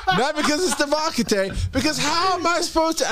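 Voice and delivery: high, indignant playful complaint; mock outrage. Real, not acted. To Devakate, mock outrage at a missing card.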